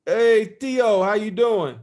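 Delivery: calls it out big like a dad on the phone; loud dad on phone. Retold or imitated. Dad voiced on phone call.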